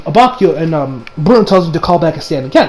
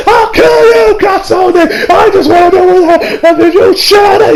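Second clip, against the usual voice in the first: very high shouted villain threat; hammed-up hysterical fury; mock ranting; 12 semitones higher, 4 dB louder, slower than usual. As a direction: rant it like a cartoon villain losing it. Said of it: "The same thing happens here".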